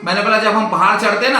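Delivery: normal talking voice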